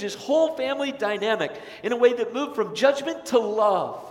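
rising pulpit fervour, loud and driving; preaching at full force. Acted. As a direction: let the sermon swell until it rings. Preaching builds to climax.

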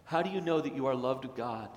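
ordinary talk, nothing marked

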